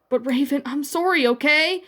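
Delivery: a hurt cry pushed up high; crying in pain. A narrator's reading. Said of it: "Starfire cried in pain".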